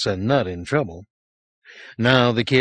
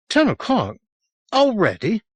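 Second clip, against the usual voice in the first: put-on higher, louder startled child; alarmed boy; 12 semitones higher, 3 dB louder than usual. A narrator's reading. Frightened boy learns the hour from the sergeant, higher and alarmed. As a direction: gasp it out in alarm.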